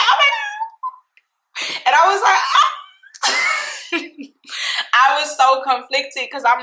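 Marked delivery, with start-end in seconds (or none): 0.0-2.7 high squealing gush; giddy high-pitched gush; excited squeal. Real, not acted. Squealing at boyfriend's sweet question. 3.1-4.2 airy unvoiced giggle; flustered little giggle; breathy giggle. Real, not acted. Laughing after squeal.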